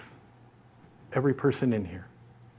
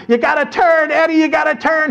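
high-pitched warning yell; frantic yelling; 13 semitones higher, 4 dB louder than usual. Retold or imitated. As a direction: shout it high, urging a friend to turn. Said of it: "we're yelling".

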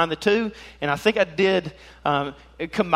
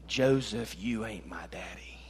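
low voice dropping to a whisper; hushed put-down; 6 semitones lower, 10 dB quieter than usual. Acted. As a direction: deliver the sting softly, almost whispered. Boy's stinging aside to Joseph.

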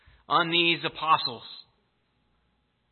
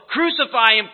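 a louder, higher mob cry; mock crowd yell; 5 semitones higher, 7 dB louder than usual. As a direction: shout it like the stirred-up crowd. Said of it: "stirred up the crowds to yell".

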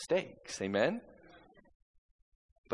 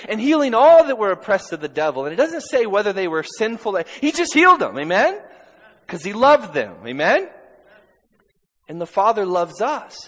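high, loud, driving sermon; full-force preaching; 8 semitones higher, 13 dB louder than usual. Acted. Pastor preaching healing to the church at full force. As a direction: preach it with fire.

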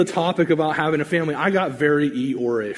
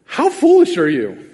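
rebuking; 5 semitones higher, 5 dB louder than usual. Retold or imitated. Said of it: "looks at them and goes".

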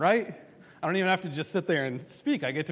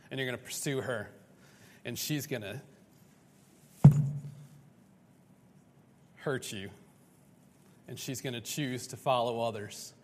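softer, heavy warning of hurt; somber foretelling; 4 semitones lower, 5 dB quieter than usual. Retold or imitated. God warning Hosea of coming heartbreak, softer. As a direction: lower your voice, lay out the pain.

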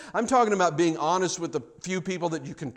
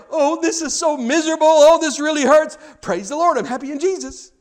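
high, loud mock complaint then forced praise; hammed-up hurting believer pretending to be fine; mock churchgoer; 10 semitones higher, 8 dB louder than usual. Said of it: "We're like".

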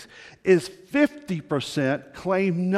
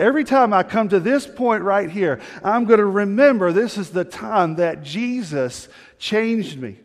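retold joy; 5 semitones higher, 6 dB louder than usual. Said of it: "And he celebrated".

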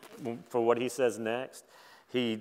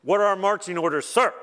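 higher, louder soldier at attention; soldier reporting; 6 semitones higher, 5 dB louder than usual. Acted. Lightning bolts lined up before God, soldierly. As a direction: report for orders like an eager recruit. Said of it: "lightning bolts line up in formation".

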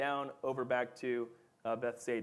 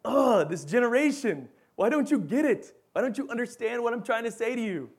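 exasperated outburst, high and loud; groan, then plead in hammed-up frustration; acting out a teacher at wit's end; frustrated; 8 semitones higher, 6 dB louder than usual. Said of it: "And he's frustrated".

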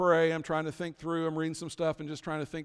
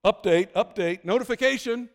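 urgent alert; 5 semitones higher, 7 dB louder than usual. Pretend. Mimicking phone alerts.

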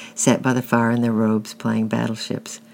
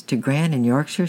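usual speaking voice

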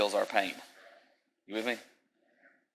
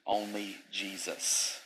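normal talking voice

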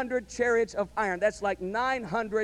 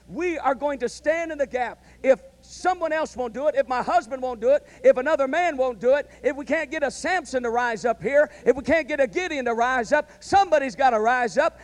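rising, loud preaching, building to the end; preaching fervor; 3 semitones higher, 6 dB louder than usual. Acted. Preacher voicing the women's vow, building louder. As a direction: build it up like a revival call.

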